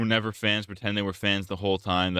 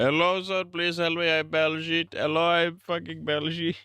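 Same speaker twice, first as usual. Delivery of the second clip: much higher, hammed-up mock Indian accent; a pleading Baljeet caricature with a mock Indian accent, pitched way up; 9 semitones higher than usual. Vlogger goes back to mocking Baljeet, begging in a much higher mock Indian accent. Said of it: "I talk like this because I'm Indian".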